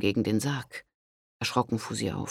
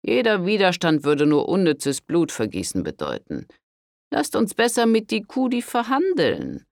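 high, loud, stern rebuke of a priest; 6 semitones higher, 4 dB louder than usual. A narrator's reading. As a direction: scold her like a severe father confessor. Priest urges negotiation. (strenger Pater, tadelnd)

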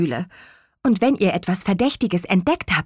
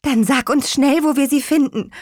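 urging; 6 semitones higher, 3 dB louder than usual. Acted. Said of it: "drängte Petronella".

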